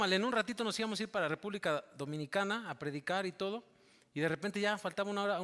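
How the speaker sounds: ordinary talk, nothing marked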